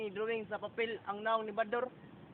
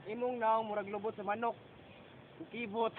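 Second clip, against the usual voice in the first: ordinary delivery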